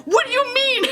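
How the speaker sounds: squeaky, high laugh breaking through; real laughter cracking into a squeal; high nervous laugh. Real, not acted. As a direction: laugh it off, spooked and giddy. Freaked out recalling ghost mom.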